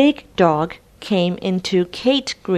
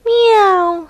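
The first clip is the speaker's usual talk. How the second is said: high, louder little meow; storybook cat mewing; 14 semitones higher, 4 dB louder than usual. Acted. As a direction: mew like a cat stuck up a tree. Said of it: "said Tabby".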